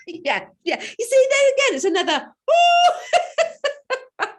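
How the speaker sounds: squealing with laughter, high and loud; laughing. Real, not acted. Client laughing at herself to her coach, high and loud. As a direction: laugh the words out helplessly.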